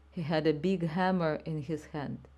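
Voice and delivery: ordinary delivery, nothing marked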